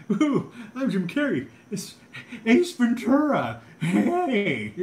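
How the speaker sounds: loud, high, stretched manic comic; hammed-up squeal breaking into laughs; Jim Carrey. Introducing himself as Ace Ventura, loud manic Jim Carrey. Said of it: "His Jim Carrey impression".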